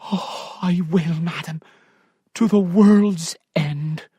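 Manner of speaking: lightly voiced loyal servant; devoted maid.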